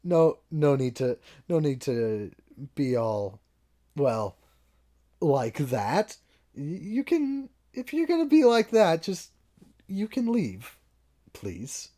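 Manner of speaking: high, loud pleading bartender; flustered bartender. Acted. Bartender rushing over to stop the fight, loud and high. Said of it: "the bartender comes over and goes".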